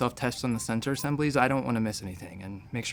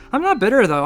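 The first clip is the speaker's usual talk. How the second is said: loud, high, bitterly sarcastic protest; bitter denial; 7 semitones higher, 8 dB louder than usual. Acted. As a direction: protest far too loudly and high. Sarcastic rant about own past.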